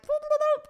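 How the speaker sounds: high, silly sputtering noise for a scrambled brain; quick burst of comic nonsense sound; frazzled imitated noise. Shown as a demonstration. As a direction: make a goofy high-pitched noise of being a mess. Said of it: "I was a little bit of a mess".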